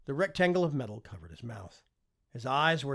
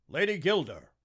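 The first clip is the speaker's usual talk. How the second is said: forceful address; 5 semitones higher, 7 dB louder than usual. A narrator's reading. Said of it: "the force of his voice startling her".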